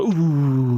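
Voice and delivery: long drawn-out crowd "ooh"; spectator's ooh. Acted. Spectator reacts to a missed ball, long sympathetic ooh. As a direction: draw out an ooh at a near miss. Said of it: "I'll miss a ball and I go".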